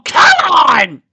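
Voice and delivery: high, drawn-out yell of protest; full-voiced "oh come on" holler; exasperated shout. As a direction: burst out loudly, stretching the words. Outburst cutting off the pony names.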